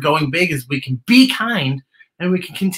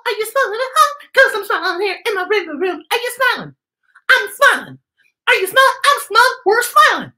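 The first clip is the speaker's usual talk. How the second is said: very high, silly squeaky voice; real silly; 4 dB louder than usual. Acted. Host hamming it up for kids at home, silly high voice. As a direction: go goofy and high, playing for the kids. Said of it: "Nina's being real silly today".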